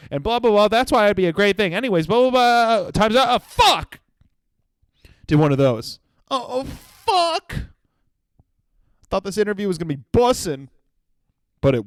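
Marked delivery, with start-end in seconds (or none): 0.1-4.0 higher, louder rushing babble; mock panic ending in a curse; frantic rambling. Replaying panicked interview answer. 6.3-7.6 slow, high, breaking-voiced "oh, oh, fuck"; mock despair; defeated groan. Despair after the timer.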